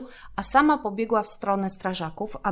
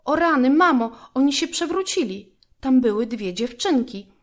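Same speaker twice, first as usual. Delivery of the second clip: put-on higher, louder alarmed girl's cry; shouting in alarm; 5 semitones higher, 5 dB louder than usual. A narrator's reading. Girl alarms mum about the capsized kayak, loud frightened shout. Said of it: "krzyknęła Małgosia".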